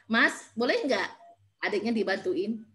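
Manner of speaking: slow, measured mother's request; short, spaced request. Shown as a demonstration. Mother asking son to help his sibling, slow concise request. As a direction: ask briefly and unhurriedly, pausing midway. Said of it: "jangan lebih dari sepuluh kata".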